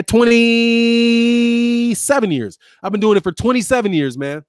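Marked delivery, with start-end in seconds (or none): 0.0-2.6 slow proud emphasis; drawn-out emphasis. Real, not acted. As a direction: stretch Twenty-seven years out very slowly and loudly.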